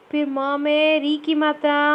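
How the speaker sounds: usual speaking voice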